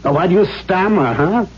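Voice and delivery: ordinary talk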